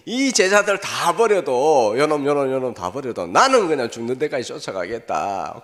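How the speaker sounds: Peter shouting his vow at the disciples; loud boast. Retold or imitated. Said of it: "막 큰 소리 지르더니만은".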